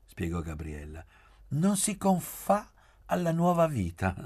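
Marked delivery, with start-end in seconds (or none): 1.5-4.1 high, lilting, amused delivery; smiling irony. A narrator's reading. Gabriella explaining Poli's new life, smiling irony. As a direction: say it through a smile, pitched well up and louder.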